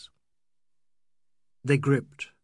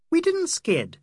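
put-on much higher, louder young woman; Adela's voice; 6 dB louder, slower than usual. A narrator's reading. Adela correcting the account of the accident, high and emphatic. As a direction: contradict them in a high female voice. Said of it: "said Adela".

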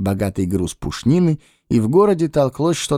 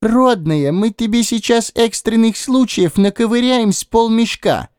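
cheeky Lis voice; 11 semitones higher than usual. Acted. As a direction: needle the captain in a high voice.

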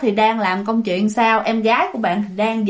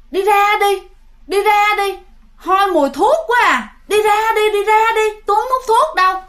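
put-on high, pleading eerie girl voice, much higher; possessed girl; 8 semitones higher than usual. Possessed girl repels them.

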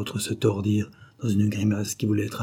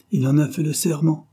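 higher, insistent assertion; absolute conviction; 6 semitones higher, 4 dB louder than usual. A narrator's reading. Dying man vouches for the sworn oath, convinced. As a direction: insist with raised, earnest certainty. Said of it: "le ton dont elle avait été faite, une si absolue conviction".

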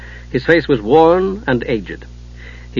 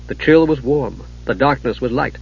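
ordinary delivery, nothing marked

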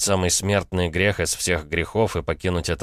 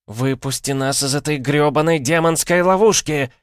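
high, loud shriek; 9 semitones higher, 5 dB louder than usual. Acted. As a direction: break into a shriek. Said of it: "сорвалась на визг".

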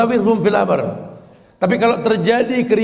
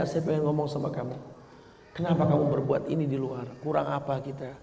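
calm dialogue; 7 semitones lower, 11 dB quieter than usual. Acted.

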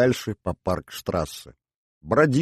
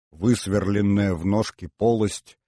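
ordinary delivery

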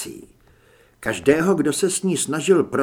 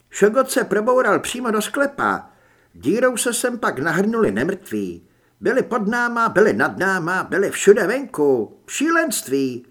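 lightly voiced Holly, higher and a little louder; higher-pitched, urgent report of an attack; Holly's character voice; 5 semitones higher, 4 dB louder than usual. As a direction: recount it in a lightly raised character voice. Voices Holly telling the events.

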